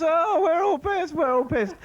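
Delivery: high, giddy mock-drunk boast; drunk teenager. Comic mimicking his teen self after one swig, giddy. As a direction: crow it like a tipsy fifteen-year-old. Mates chorus being drunk.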